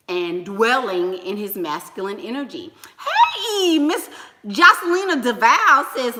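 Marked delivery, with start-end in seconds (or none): 3.0-5.9 loud, high-pitched shout-out; excited greeting. Real, not acted. Streamer greets Miss Jocelina before reading her comment, excited greeting. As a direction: call out happily to a fan who just arrived.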